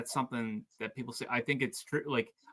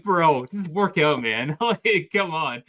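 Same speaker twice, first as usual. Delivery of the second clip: loud, higher, laughing protest; mock exasperation; 5 semitones higher, 9 dB louder, slower than usual. Gym-goer telling a staring guy to get on with it, loud mock exasperation. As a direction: tell off a distracted buddy, half-amused.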